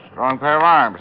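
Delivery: strong pair of arms said loud and pointed; a loud, pointed dig at the masseuse; pointed jab. Acted. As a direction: lean hard on the arms jab. Detective needling the suspect.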